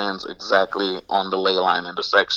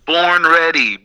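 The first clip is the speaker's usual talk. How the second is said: Born Ready said louder, higher and clearly; louder and clearer; 6 semitones higher, 5 dB louder, slower than usual. Real, not acted. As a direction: call out Born Ready into the phone. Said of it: "go ahead".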